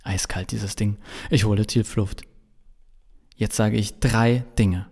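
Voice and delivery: usual speaking voice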